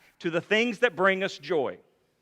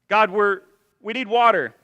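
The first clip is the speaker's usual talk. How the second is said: loud pleading cry to God, higher; desperate cry; 5 semitones higher, 9 dB louder than usual. Retold or imitated. Thirsty people crying out to God, loud and pleading. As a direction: cry out loud for water, begging.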